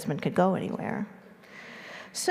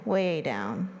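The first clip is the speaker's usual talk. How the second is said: plain reading voice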